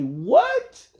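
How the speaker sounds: high, loud startled what; reacting to a coworker's shout; shocked what. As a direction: blurt it in disbelief. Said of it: "I was like".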